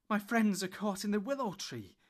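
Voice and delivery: loud, high, urgent plea; breathless cry. Acted. Frodo begging Tom for help, breathless. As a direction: gasp it out in alarm. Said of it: "cried Frodo breathlessly".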